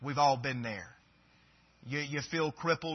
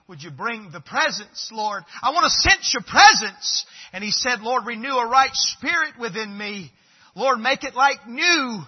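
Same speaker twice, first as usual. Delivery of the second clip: building from pleading to a loud, higher cry; David crying out to God for his presence; impassioned prayer; 6 semitones higher, 11 dB louder than usual. Acted.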